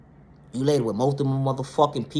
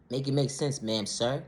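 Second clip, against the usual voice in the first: usual speaking voice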